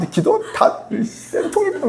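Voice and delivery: slow, laughing, high gloat; hammed-up inner gloating; mock gloating. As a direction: savour the 쌤통이다 with a snicker.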